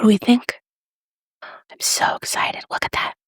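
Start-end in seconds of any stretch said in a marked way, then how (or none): 1.4-3.1 slow excited whisper; drawn-out thrilled whisper; whispering with glee. Real, not acted. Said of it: "I always whisper when I get excited".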